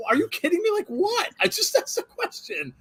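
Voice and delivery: mock indignant whine; incredulous disbelief. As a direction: play the baffled victim, voice climbing. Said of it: "and I'm like".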